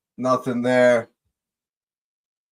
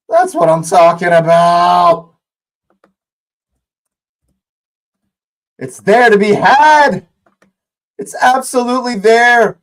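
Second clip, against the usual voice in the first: high, loud goal commentary; excited play-by-play; 8 semitones higher, 5 dB louder than usual. Real, not acted. Fan replays goal as commentary.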